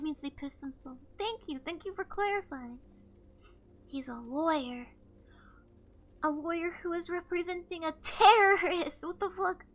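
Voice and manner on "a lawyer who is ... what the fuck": loud disbelief. Real, not acted. Recognizing the passenger.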